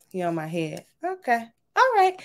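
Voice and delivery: normal talking voice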